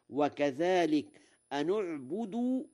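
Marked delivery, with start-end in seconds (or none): 1.5-2.6 u on the nun of أنعبد, higher pitch; damma on nun. Shown as a demonstration. Teacher gives an example, أنعبد with damma on nun. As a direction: recite أنعبد as anu'budu. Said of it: "بضم النون وصلا".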